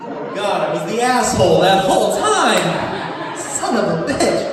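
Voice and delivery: loud, high, slow anguished cry; a hammed-up wail of self-reproach; anguished shout. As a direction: bellow it as the truth hits. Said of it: "realizing he was wrong".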